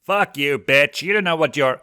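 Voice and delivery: enraged imp shouting; furious shout. A narrator's reading. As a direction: scream it back at her. Blitz yells at Veronica.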